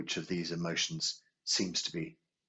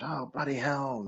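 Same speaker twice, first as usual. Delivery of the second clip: higher, slow mock outburst at a driver; a hint of road rage, pitched up and drawn out; imitating dad's shout; 8 semitones higher than usual. Dad yelling at a bad driver in the car, mock angry shout. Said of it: "and he'd shout".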